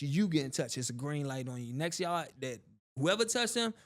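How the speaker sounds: ordinary talk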